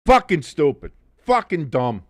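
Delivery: ordinary talk, nothing marked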